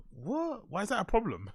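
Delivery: high-pitched, amused bafflement; incredulous mock reply. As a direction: question the warning as if absurd. Baffled by warning about voice breaking.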